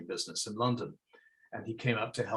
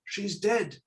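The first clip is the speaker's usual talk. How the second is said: understated anguish, pitched up without sobbing; distressed father on the phone; 3 dB quieter than usual. Acted. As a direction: break bad news in dismay, voice lifted. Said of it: "sobbing down the phone".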